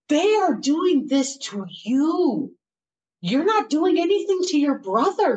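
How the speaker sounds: put-on high, emphatic boyfriend arguing back; indignant insistence. Boyfriend defending her against her family, indignant insistence.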